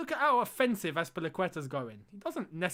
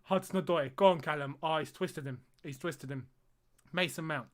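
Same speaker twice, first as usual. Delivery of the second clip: normal talking voice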